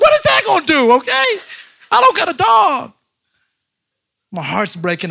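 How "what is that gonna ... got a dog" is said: high, loud mock-hysterical outburst; distraught wailing. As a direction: wail back at your wife, hammed up. Retold wailing at wife on phone.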